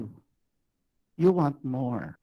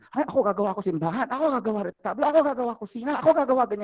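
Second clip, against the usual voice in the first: eager classmates; 10 semitones higher, much faster than usual. Retold or imitated.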